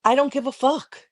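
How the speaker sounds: defiant, pitched well up; insistent defiance. Real, not acted. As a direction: throw it out high in defiance. Defiant repeat after being challenged.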